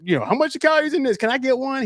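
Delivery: high, excited mock voice asking about cookies; excited imitation. Recounting the player at the press conference, high and excited. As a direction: chirp it up high like a kid eyeing a treat.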